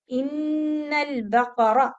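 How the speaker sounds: very slow "इन्नल बकरा" with the काफ voweled; correct बकरा. Shown as a demonstration. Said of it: "काफ को साकिन मत कीजिएगा".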